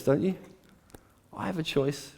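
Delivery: ordinary talk, nothing marked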